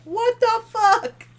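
high, slow shocked what the fuck; stretched-out stunned gasp of a curse; shocked reaction. Retold or imitated. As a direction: recoil in disbelief at the door. Both reacting to hidden door.

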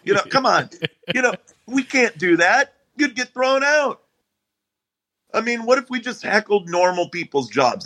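high-pitched comic rant at the crowd; bitterly ranting at the audience for laughs; stand-up rant. Acted. Comedian ranting at hecklers during his bit, pitched high.

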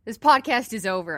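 loud mock outrage. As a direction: declare it over, hammed-up. Mock outrage over cake donuts.

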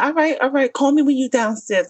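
lightly mocking boyfriend. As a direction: play him pleased and easy. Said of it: "He said".

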